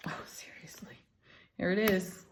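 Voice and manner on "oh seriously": breathy aside under the breath; whisper. Real, not acted. Reader whispering to herself at a stubborn card. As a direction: whisper it to yourself, half exasperated.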